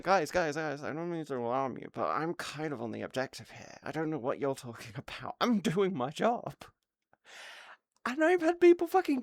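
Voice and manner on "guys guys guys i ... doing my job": quiet, lower, mock-polite calm; soft mock-innocent explanation; deadpan calm. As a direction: calmly point out the obvious, hushed. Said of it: "I was like".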